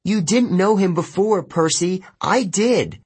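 high-pitched, heated outburst; upset yell. A narrator's reading. Annabeth defends Luke to Percy, upset yelling. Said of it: "Annabeth yelled".

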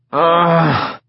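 short high groan of pain. Acted. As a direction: groan like you're hurt. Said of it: "Sid groaned".

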